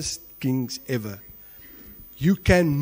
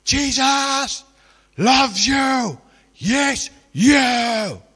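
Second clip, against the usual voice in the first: loud, high proclamation; preacher shouting to shoppers from the escalator; shouted; 6 semitones higher, 4 dB louder, much slower than usual. Retold or imitated. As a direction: call it out across the mall. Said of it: "Shouted at the top of his voice".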